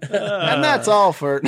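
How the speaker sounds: words dissolving into laughter; laughing. Real, not acted. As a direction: laugh through the sign-off. Sign-off broken by laughter.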